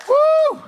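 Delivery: high whooping cheer; woo. Pretend. Comic whoops back at parents in the crowd, high cheer.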